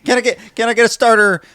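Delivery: high, loud, fast auctioneer patter. Shown as a demonstration. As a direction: rattle off bids like an auctioneer. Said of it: "How about you do a, uh, an auctioneer".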